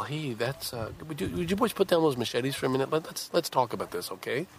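mocking a gentle appeal to killers; mock polite plea. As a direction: pretend to reason nicely with armed men.

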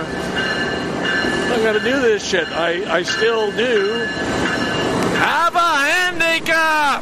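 loud, slow, high exasperated cry; venting loudly about needing to manage a handicap; frustrated outburst. Real, not acted. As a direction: let the frustration burst out at the end.

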